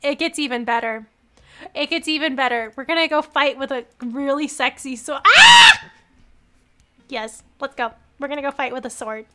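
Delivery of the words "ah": very loud, high squeal; excited scream. Real, not acted. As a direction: shriek with fangirl excitement. Excited scream over game.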